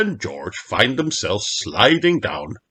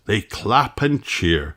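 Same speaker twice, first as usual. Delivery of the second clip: plain reading voice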